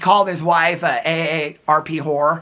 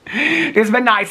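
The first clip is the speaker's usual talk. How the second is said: slow words through a real laugh; 6 semitones higher, much slower than usual. Real, not acted. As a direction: let the laugh carry it. Laughing farewell.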